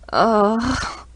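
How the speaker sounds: loud disgusted groan. A narrator's reading. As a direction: groan in embarrassment. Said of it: "I groan".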